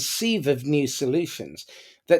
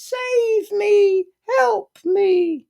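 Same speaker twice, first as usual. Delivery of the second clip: high, louder plea for rescue; pleading victim; 4 dB louder, much slower than usual. Retold or imitated. Victim begging to be rescued, high pleading cry. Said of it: "their favourite byline is".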